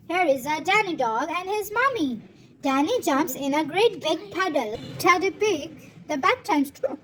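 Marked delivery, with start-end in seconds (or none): none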